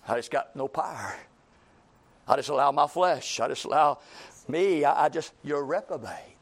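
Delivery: a hint of a shrugging kid; sulky child excuse. Child answering the scolding parent, lightly voiced mumble.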